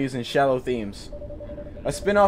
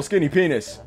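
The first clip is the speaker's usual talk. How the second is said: normal talking voice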